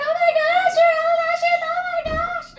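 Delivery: loud, very high fangirl squeal; gushing admirers. Retold or imitated. Mom imitating strangers admiring her son's eyelashes, loud high squeal. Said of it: "they would always be like".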